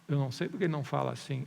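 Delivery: quick, unbothered reply; brisk, toned-down concession; matter-of-fact admission. Shown as a demonstration. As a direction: brush the question off calmly and fast. Preacher's own answer, same words.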